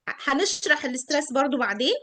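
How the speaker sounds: ordinary talk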